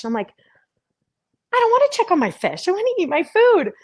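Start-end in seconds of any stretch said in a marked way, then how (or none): 1.5-3.7 whiny protest. Retold or imitated. Arguing with inner voice.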